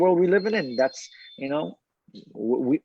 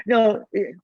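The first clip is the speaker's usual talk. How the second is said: usual speaking voice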